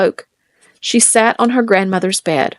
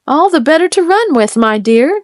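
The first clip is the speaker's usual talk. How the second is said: wolf answers eagerly, pitched up; wolf crying out; 6 semitones higher than usual. A narrator's reading. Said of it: "cried the wolf".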